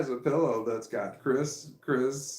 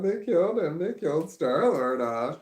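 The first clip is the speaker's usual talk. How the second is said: mock sob; 5 semitones higher, 5 dB louder than usual. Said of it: "She's, like, crying her eyes out".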